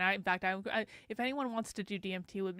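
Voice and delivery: ordinary delivery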